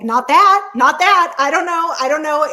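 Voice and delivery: high, loud, panicky protest, retold; flustered panic. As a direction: blurt it out in a mock panic. Panicked denial to annoyed husband.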